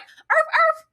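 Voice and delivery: high-pitched little dog yaps; playful high bark; Egg. Retold or imitated. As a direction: yip like an eager puppy. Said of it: "And Egg's like".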